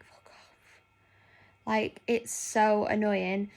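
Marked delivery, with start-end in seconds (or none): 0.0-0.8 voiceless muttered whisper; whispered retort. Retold or imitated. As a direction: whisper the swear under the breath. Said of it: "I'm like".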